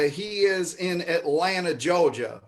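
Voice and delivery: slow, hammed-up Georgia drawl; overdone Southern accent, like Foghorn Leghorn; attempted Georgia accent. Host introducing a performer from Atlanta, in a bad Georgia accent. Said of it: "anytime I try to do a Georgia accent".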